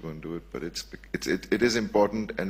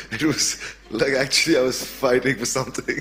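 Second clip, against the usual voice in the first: laughing; 6 semitones higher, 9 dB louder than usual. Real, not acted. Laughing at his own frustration.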